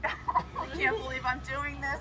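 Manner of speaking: laughing nervously, close to tears. Real, not acted. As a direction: laugh through the nerves. Nervous before the ice bucket.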